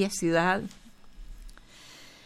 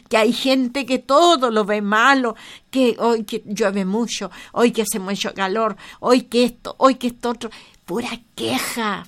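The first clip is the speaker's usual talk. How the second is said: usual speaking voice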